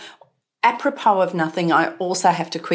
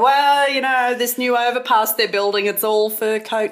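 higher-pitched mock British regional accent; 5 semitones higher than usual. Commenting on a new overpass, in mock UK accent. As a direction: borrow a badly done regional UK accent. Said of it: "I will try and do the accent".

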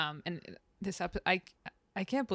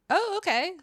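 high, easygoing okay; light and accepting, pitched up; totally supportive; 12 semitones higher, 4 dB louder than usual. Retold or imitated. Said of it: "And Pacey's like".

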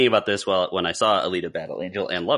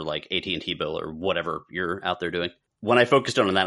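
usual speaking voice

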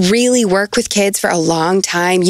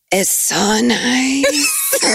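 slow, laughing "so nice" into high laugh; laughing delight; 7 semitones higher, much slower than usual. Real, not acted. Laughing with delight about her own place. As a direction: laugh out the joy.

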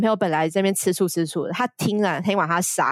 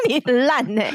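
laughing tease; 6 semitones higher than usual. Real, not acted.

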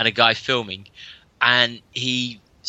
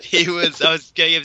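laughing; 6 semitones higher than usual. Real, not acted. Laughing at drunk runner story.

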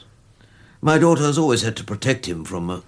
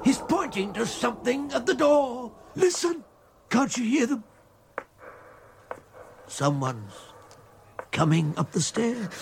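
high, softer dread-filled voice; hushed dread; 10 semitones higher, 5 dB quieter than usual. Acted. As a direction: warn quietly of something coming. Grandfather hushed dread at ghost.

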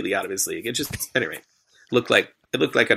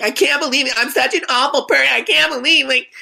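mock ranting woman; 14 semitones higher, 4 dB louder than usual. Imitating the ejected woman's rant.

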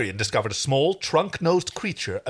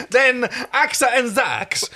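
put-on loud, slow Italian-accented line; 10 semitones higher, 5 dB louder than usual. Stark gesturing to each delegate, in an Italian accent. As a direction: throw in a hammy Italian accent. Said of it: "Oh, he is Italian".

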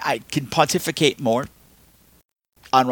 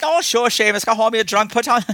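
mock Irish pub scold; 8 semitones higher than usual. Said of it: "So it'd been like".

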